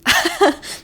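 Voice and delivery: short high burst of laughter; surprised laugh. Real, not acted. Laughing to viewers at a huge surprise book.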